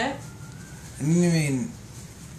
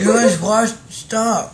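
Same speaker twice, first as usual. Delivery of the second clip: loud protest; 8 semitones higher, 12 dB louder, slower than usual. Real, not acted. Panicked protest.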